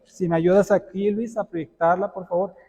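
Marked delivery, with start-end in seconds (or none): none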